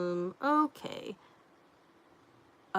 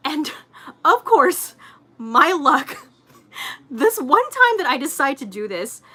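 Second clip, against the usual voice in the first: laughing through the words, higher and louder; can't hold back the giggles; 7 semitones higher, 9 dB louder than usual. Real, not acted. Telling chat the ikea story, laughing.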